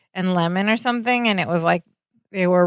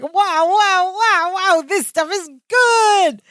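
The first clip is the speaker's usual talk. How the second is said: much higher, stretched out ooh wow; drugged-up wonder; 10 semitones higher, slower than usual. Retold or imitated. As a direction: gasp it like the drug just hit. Balki euphoric after the root.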